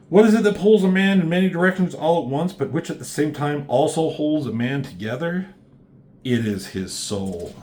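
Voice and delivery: mock-solemn poetic reading; poem recital. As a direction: read it like a grand poem. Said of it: "thanks for the poem".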